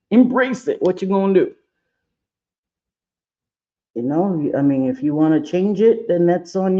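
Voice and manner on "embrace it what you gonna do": Embrace it said high and loud with a push; rallying the viewers, Embrace it louder and higher; emphatic. Shown as a demonstration.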